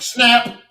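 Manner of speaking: high, loud "snap"; sudden sharp sound effect; imitated breaking snap. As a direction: punch out the sound of something breaking. Imitates clarinet tenon breaking.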